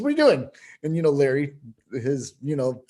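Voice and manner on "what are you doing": jumped way up high, bouncy and teasing; high playful mock of a friend walking up; Larry's woo-hoo voice. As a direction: pop it up high like a cheeky mentor. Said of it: "he looks at me and says".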